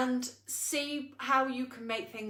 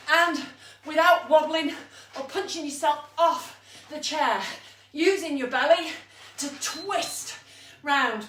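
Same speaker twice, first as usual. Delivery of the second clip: raised, energetic instructor call-outs; fitness class coaching; 6 semitones higher, 5 dB louder than usual. Real, not acted. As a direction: urge the class on through the last round, pitched up. Coaches a live exercise round.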